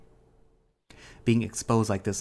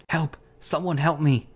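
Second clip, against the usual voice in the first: high, loud cry for help; urgent plea, raised high; desperate call for help; 7 semitones higher, 5 dB louder than usual. A narrator's reading. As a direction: call out in panic, pitched well up. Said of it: "I try to call out".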